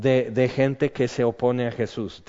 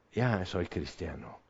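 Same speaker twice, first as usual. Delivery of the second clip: hushed confession; 6 semitones lower, 11 dB quieter than usual. Pretend. New believer confesses to a friend.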